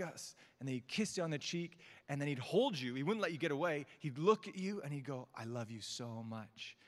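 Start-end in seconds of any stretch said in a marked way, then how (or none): 5.3-6.8 put-on slow, soft, light Egyptian accent; a gentle hint of an Egyptian grandfather's accent, slowly; tender Egyptian-accented grandpa, slow and soft. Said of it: "In his Egyptian accent".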